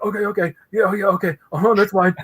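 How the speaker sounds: high, flustered string of agreement; starstruck babble. Retold or imitated. Shocked fan answering the apologizing artist, flustered. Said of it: "that's all I freaking said was like".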